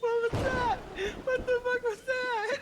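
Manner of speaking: high, loud laughing disbelief; cracking up while asking; squealed question through laughter; laughing incredulously. Real, not acted. Cracking up at the minion voice.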